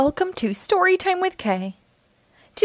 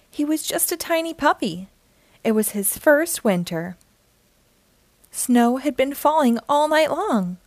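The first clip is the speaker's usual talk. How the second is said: usual speaking voice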